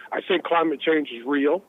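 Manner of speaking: normal talking voice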